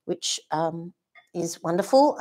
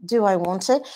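normal talking voice

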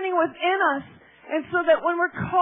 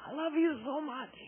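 very soft, hushed quote; tender declaration; 3 semitones lower, 12 dB quieter than usual. Retold or imitated. God telling her his love over and over, tender and hushed. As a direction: voice it very softly, with tenderness.

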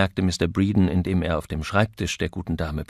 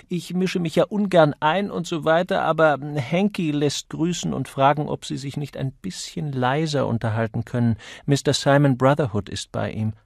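raised voice, easing down as it goes; worried bleating; 6 semitones higher, 5 dB louder than usual. A narrator's reading. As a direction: politely butt in with concern. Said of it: "blökte er besorgt".

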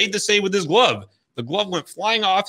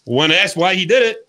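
ordinary delivery

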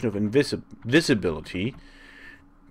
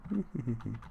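soft chuckle; 5 semitones higher, 7 dB quieter than usual. Real, not acted. Laugh after nostalgia.

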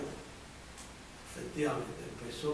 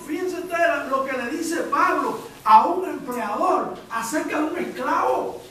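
loud, high fervent preaching; full preaching; 10 semitones higher, 10 dB louder than usual. Acted. Preacher urging the congregation toward the letter to Philemon, in fervent full-voiced preaching. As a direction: drive the point home to the pews.

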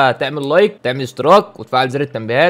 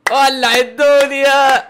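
mock dramatic; 11 semitones higher, much slower than usual. Mocking the surprise engagement.

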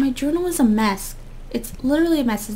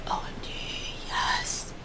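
excited whisper; 11 dB quieter than usual. Real, not acted. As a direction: hush your delight. Whispered delight at corset.

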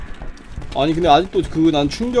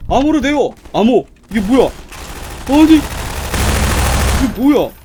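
loud, raised imitation turning to disbelief; incredulous mock outburst; 4 semitones higher, 3 dB louder than usual. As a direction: shout back the absurd exchange in protest. Mimics absurd password exchange, outraged. (어이없어서 크게 따라 외치기)

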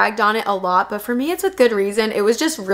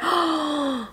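sharp high gasp of delight; a real little gasp of surprise; surprised gasp; 6 semitones higher, 6 dB quieter than usual. Real, not acted. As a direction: catch your breath at something lovely. Spots surprise ottoman in catalogue.

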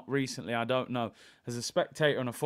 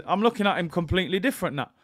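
ordinary talk, nothing marked

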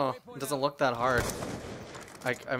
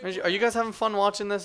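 normal talking voice

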